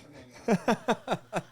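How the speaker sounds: real laugh, high and bright; high burst of laughter. Real, not acted. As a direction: let a big laugh out. Joking guest invite.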